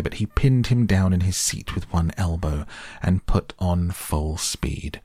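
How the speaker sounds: plain reading voice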